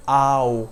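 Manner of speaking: ow gliding from ah to oh; diphthong demo. Shown as a demonstration. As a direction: open the mouth twice for ow. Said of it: "the first is".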